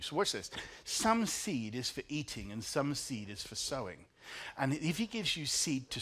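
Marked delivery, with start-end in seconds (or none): none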